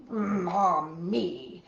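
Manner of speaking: grumbling. Acted. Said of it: "Grandpa grumbled".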